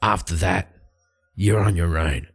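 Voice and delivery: low, grudging Light voice. Acted. Light agrees to one job with Beric, low and grudging. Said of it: "And Light turns to him and goes".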